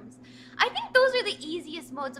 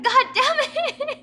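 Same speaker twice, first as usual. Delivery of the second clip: laughing mock outburst; mock curse; 10 semitones higher, 7 dB louder than usual. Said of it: "I'm like".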